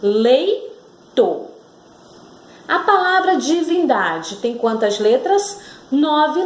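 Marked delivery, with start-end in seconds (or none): 0.0-1.5 "Leito" split into two syllables with a pause. Shown as a demonstration. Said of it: "Quantas sílabas? Duas sílabas".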